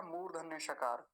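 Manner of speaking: normal talking voice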